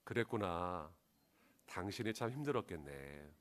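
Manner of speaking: tender reassuring husband; empathetic. Shown as a demonstration. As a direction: say it like you truly feel her hurt. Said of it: "상한 감정을 그대로 읽어주시면 돼요".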